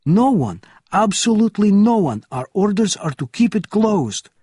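loud, high, emphatic denial; agitated officer. A narrator's reading. Agitated officer answers Bourne in crisis.

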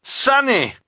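very high, loud name call; sudden high shriek, falling away; horrified scream. A narrator's reading. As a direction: scream for a sibling in danger. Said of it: "Klaus screamed".

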